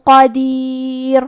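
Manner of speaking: slow, melodic recitation; Quranic recitation. Acted. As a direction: chant the surah with tajweed. Recites full passage.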